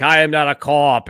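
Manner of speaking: loud, mock protest to a home assistant; arguing with Alexa. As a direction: mimic a man arguing with his smart speaker. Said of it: "Mike talking to his, like, home assistant".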